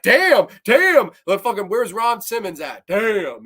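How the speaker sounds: loud, high yelled damn damn; mock shouting damn. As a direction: shout it like an excited wrestling fan. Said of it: "I was like".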